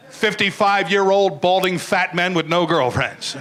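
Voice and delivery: normal talking voice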